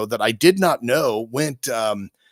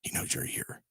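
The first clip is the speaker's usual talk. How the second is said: whisper; 14 dB quieter than usual. Pretend. Reply about Satan's call.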